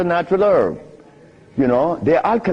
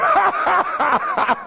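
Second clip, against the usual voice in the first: big laugh. Real, not acted. Laughs at his own boast.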